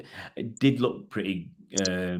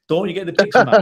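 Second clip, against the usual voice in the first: a laughing, louder jab at a friend; laughing exasperation; 7 semitones higher, 9 dB louder, faster than usual. Real, not acted. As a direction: laugh it out, higher and louder, teasing. Laughing at friend's missing the point.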